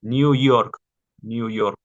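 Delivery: New York said in English with an English Y; English example. Shown as a demonstration. Teacher giving an English word to show the Y. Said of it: "como suena la Y en el inglés".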